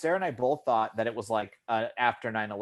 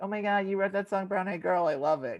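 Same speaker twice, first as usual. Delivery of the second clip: put-on high, excited fan girl voice; gushing fan girl; 8 semitones higher than usual. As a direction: squeal like a starstruck fan.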